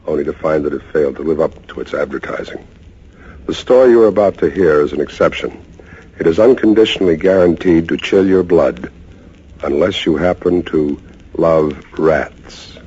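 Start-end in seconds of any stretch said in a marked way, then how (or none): none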